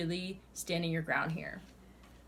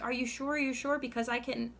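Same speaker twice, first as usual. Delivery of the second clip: higher-pitched imitation of someone bargaining; mock insistent questioning, pitched up; pleading doubt; 6 semitones higher than usual. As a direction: mimic a person refusing to accept it. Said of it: "and then you're like".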